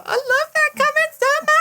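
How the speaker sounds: very high squealing mock gush; hammed-up high-pitched delight; gushing fan. Acting out delighted fan reply.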